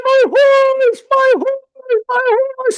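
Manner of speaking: high, loud, drawn-out mock wail; crying out. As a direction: act out a distraught villager wailing at full voice. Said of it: "The villager cried".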